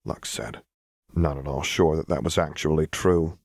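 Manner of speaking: ordinary delivery, nothing marked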